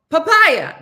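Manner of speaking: loud, high yell; yelled catchword. Shown as a demonstration. Telling listeners the new phrase for Chris, yelled catchword. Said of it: "just yell "papaya".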